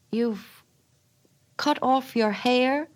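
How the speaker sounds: slow, dazed question; each word dragged out in disbelief; laborious. A narrator's reading. Husband stunned at wife's cut hair, slow and laborious. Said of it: "asked Jim laboriously".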